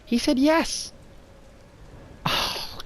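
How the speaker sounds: put-on high, delighted young woman's voice; thrilled [name]. [name] telling Lara the uncle agreed, high thrilled woman's voice. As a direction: squeal the good news up high.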